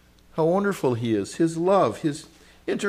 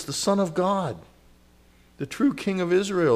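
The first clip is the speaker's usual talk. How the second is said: usual speaking voice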